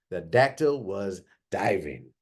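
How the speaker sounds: ordinary delivery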